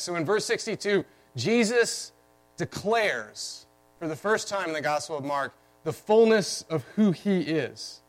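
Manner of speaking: ordinary talk, nothing marked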